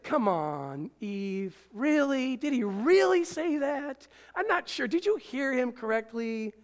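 lightly voiced sly tempter, drawn-out doubting questions; Satan tempting Eve. Preacher voicing Satan tempting Eve, a hint of a sly tempter. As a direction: coax her like the serpent, half-mocking. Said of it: "but Satan's like".

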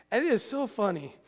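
ordinary talk

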